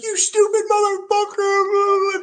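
mock a squeaky screaming teammate; piercing high yell of outrage; high-pitched yell. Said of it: "just high pitch".

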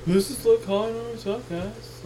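high-pitched distant mock voice; imitated distant talking. Host mocking co-host's mic habit, high distant imitation. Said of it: "you'll be talking like this".

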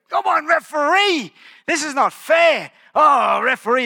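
high, loud protest at the ref; frustrated shout. Retold or imitated. Player shouting at the referee, high and loud. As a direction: yell it like a wronged player. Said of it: "I was like".

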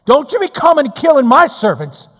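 loud, high hammed-up telling off; what Abigail did not shout at David; mock angry scolding. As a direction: scold him like a fed-up wife. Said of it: "She didn't say".

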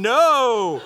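hammed-up refusal; mock dread. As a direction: recoil in comic horror. Horrified thought at being called up.

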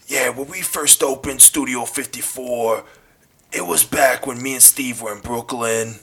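imitating a raspy Brooklyn accent. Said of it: "the perfect accent between a Brooklyn accent and a true cigarette smoker accent".

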